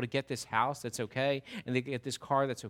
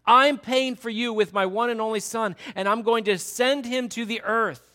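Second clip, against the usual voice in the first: loud, higher, full-force preaching as God; God proclaiming; 7 semitones higher, 8 dB louder than usual. Acted. Preacher voicing God to the congregation, loud passionate proclamation. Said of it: "And here God says".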